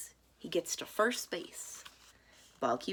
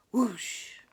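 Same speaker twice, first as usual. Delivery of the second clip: whoosh; 5 semitones higher, 6 dB louder than usual. Acted. Pitch whooshing past.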